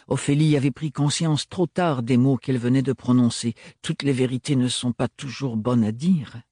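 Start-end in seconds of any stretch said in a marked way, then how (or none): none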